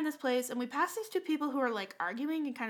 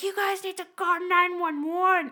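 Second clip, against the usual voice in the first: raised, high-pitched mock alarm call; urgent shout; 6 semitones higher, 7 dB louder than usual. Jogger bursts from bushes to alert picnickers, urgent shout. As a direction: imitate a frantic jogger, loud and high.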